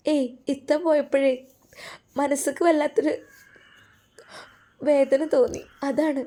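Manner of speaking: put-on high, halting young-wife voice; mildly upset wife. Upset wife explains her sadness. (സങ്കടത്തോടെ, ഉയർന്ന സ്വരത്തിൽ നിർത്തി നിർത്തി)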